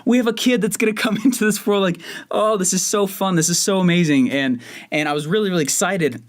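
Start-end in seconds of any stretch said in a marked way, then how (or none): 0.1-4.3 higher, jumping-around glee; retold excitement. Husband hearing about the baby, higher giddy joy. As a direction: relive the joy of the news. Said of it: "I'm going".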